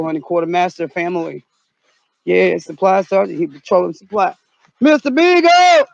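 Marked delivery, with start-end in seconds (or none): 4.8-5.8 loud, high, drawn-out holler; calling out. Pretend. Owner hunting for lost dog, loud long call. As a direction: holler slowly for the lost dog, rising at the end.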